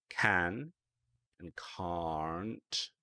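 can't said with a long ah, almost cahrnt; British can't. Shown as a demonstration. Teacher showing the British can't against can. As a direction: give can't the British open ah with a hint of R. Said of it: "the British version of can't actually sounds different".

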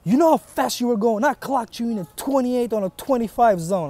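suddenly hostile traffic cop grilling the driver; stern cop. Retold or imitated. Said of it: "Tone changed immediately".

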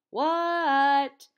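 long, high, loud holler back; yelled answer. A narrator's reading. Froggy answering friend's yell, yelled answer. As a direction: holler back, stretching the word out.

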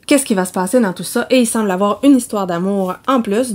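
ordinary delivery

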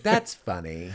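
light Mulaney imitation. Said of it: "Taran Killam does the best Mulaney".

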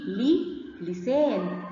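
لي drawn out long, then لسان slowly; very slow sound drill. Shown as a demonstration. Teacher modelling لي لسان very slowly for children. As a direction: stretch لي out slowly before لسان.

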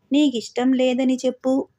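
ordinary delivery

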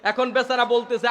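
ordinary talk, nothing marked

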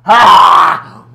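loud burning yell; pained shout. Real, not acted. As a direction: shout from the burn. Burning sour candy.

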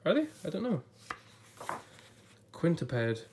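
usual speaking voice

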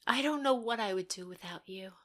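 soft unvoiced thank-you; mean it, barely breathed; whispered sincere line. Actress finally saying the line sincerely, whispered.

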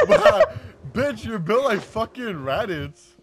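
laughing. Real, not acted. Laughing insult.

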